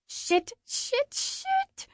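high, rising panicked curses. Acted. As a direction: panic climbing with each word. Narrator panics, hiding.

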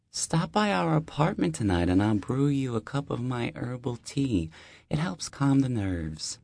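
high, friendly deli-lady voice; warm older neighbor. Acted. Deli owner welcoming the new neighbor, high and friendly. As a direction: greet him like an old friend at the door.

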